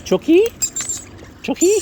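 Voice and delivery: high loud call; calling chickens. Real, not acted. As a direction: call out to the chooks. Calling birds to come.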